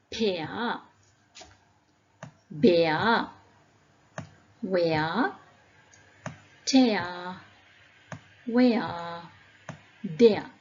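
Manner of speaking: "Pear", "Bear", "Tear" drawn out singly as eh-ah; eh-ah sound demo. Shown as a demonstration. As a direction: model "Where" and "There" with a long open glide. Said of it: "they don't pronounce as".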